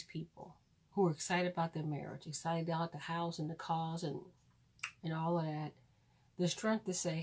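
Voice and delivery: half-joking heartfelt prayer; mock prayer.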